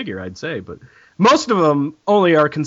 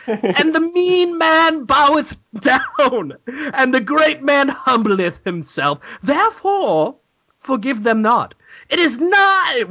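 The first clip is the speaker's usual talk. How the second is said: mock scripture reading; 8 semitones higher, slower than usual.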